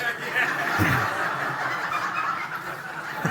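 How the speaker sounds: breathy unvoiced laugh; quiet wheezing laugh. Real, not acted. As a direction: laugh at his own joke. Laughing at own joke.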